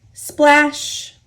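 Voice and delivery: ordinary delivery, nothing marked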